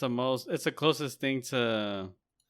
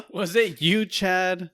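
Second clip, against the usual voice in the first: higher, louder mock outrage; mock angry accusation; 6 semitones higher, 6 dB louder, slower than usual. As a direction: confront him like a wronged roommate. Accusing a roommate over food.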